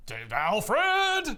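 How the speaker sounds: high, loud indignant yell; exasperated call. Retold or imitated. Groom summons butler over smashed clock, exasperated yell. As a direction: holler for the butler in outrage. Bruce calls out angrily to butler.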